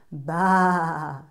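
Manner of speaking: a bleating baa; sheep's baa. Acted. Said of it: "said the sheep".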